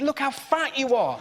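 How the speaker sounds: ordinary delivery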